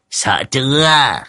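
slow, high, teasing taunt; snickering old medium. A narrator's reading. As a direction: draw it out to tease, between giggles. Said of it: "lão đồng già mới tiến lên cười hì hì".

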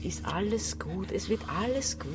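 calm them down gently and unhurriedly; soothing. Acted. Woman comforting frightened Robby, soothing. Soothes companion who is afraid.

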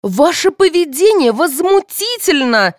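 high, slow, loud outrage; indignant shouting. A narrator's reading. Mother rebuking the policeman, shouting indignantly. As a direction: denounce him with every syllable stretched. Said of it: "закричала старшая Яковлева".

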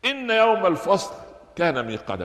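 high, intoned verse; Quran recitation. Shown as a demonstration. Teacher reciting the verse before explaining it, intoned. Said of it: "بعد ذكر النعم التسعة".

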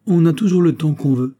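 high, loud pompous maxim; sententious. A narrator's reading. Porthos lecturing the hurried tailor, sententious. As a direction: lay down a rule sententiously. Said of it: "dit sentencieusement Porthos".

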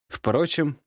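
plain reading voice

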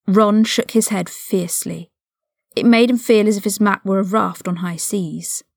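ordinary delivery

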